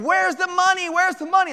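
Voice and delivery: high-pitched imitation of a screaming robber; mock scream. Robber demanding money from his victim, mock screaming. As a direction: demand it in a high, held-back yell. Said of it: "he starts screaming".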